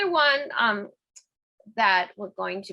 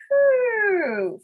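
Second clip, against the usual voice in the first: high gliding "whew"; exhaling whoop; 10 semitones higher, 4 dB louder than usual. Shown as a demonstration. Instructor demonstrating the out-breath, high whew.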